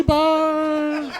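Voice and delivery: very high, loud, camp falsetto; hammed-up campy falsetto ghost. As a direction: swoop in like a diva ghost. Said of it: "just show up and be like".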